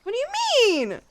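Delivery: very high, indignant question; incredulous protest. Real, not acted. Challenging a friend's dig at Mario, incredulous high cry. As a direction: squeal it in disbelief, pitch soaring then falling.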